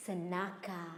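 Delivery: low, hushed narration with long pauses; ominous storytelling. A narrator's reading. Storyteller revealing the hidden snake, low ominous hush. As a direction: drop low and soft, drawing out the menace.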